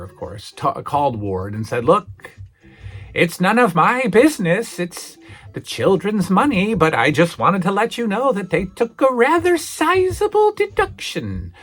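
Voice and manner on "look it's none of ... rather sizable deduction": high, prissy school principal voice; prim principal. Retold or imitated. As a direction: play the fussy principal on the phone. Principal phoning the father.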